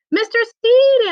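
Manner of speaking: high, bright-voiced hello; delighted greeting. A narrator's reading. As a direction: greet him with real delight. Aunt Daisy greets warmly.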